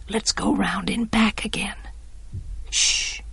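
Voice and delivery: very soft whisper; breathed. A narrator's reading. Jem leading them round back, whispered. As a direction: whisper the plan, then shush. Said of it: "breathed Jem".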